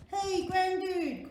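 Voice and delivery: loud, high, slow hail; cowboy calling out. A narrator's reading. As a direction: holler across the desert. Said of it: "called the cowboy".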